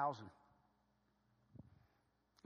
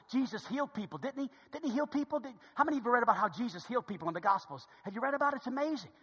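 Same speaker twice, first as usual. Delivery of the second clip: ordinary talk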